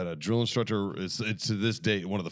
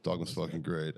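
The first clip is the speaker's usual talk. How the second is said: ordinary talk, nothing marked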